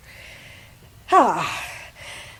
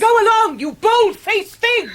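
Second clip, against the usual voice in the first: furious shout; 11 semitones higher, 5 dB louder than usual. Acted. Aunt driving the rider off her grass, scornful high yell. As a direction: drive the intruder away with a shriek of scorn.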